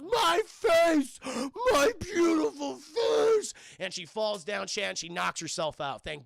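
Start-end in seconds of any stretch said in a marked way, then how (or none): 0.0-3.5 loud, high, slow mock crying; hammed-up sobbing wail over her face. Zendaya mourns her ruined face, hammed-up wail.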